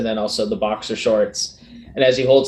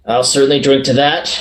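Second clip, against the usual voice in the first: louder cheerful toast; hearty toast; 3 semitones higher, 5 dB louder than usual. Acted. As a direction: raise a glass and say it warmly.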